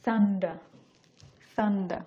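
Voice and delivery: "Thunder" with no final r, in a light British accent. Shown as a demonstration. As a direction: try a British "thunder", r dropped. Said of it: "British pronunciation করার চেষ্টা করতেছি". (হালকা British উচ্চারণে "Thunder", শেষে র নেই)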